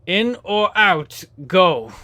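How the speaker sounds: Jersey boss pressing for an answer; Jersey accent. Acted. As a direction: drag out the question, New Jersey tough guy. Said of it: "I had to do the accent".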